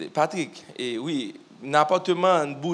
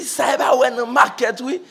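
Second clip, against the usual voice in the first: excited quote; 10 semitones higher, 8 dB louder than usual. Retold or imitated. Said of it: "il dit".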